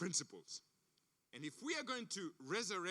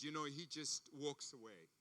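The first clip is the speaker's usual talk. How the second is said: usual speaking voice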